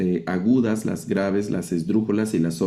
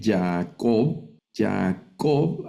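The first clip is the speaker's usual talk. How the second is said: "Jacob" stressed on the last syllable, cob; final-syllable stress; much slower than usual. Shown as a demonstration. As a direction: split "Jacob" as ja-COB, landing on the end. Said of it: "al acento al final". ("Jacob" con el acento al final)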